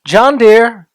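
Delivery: ordinary delivery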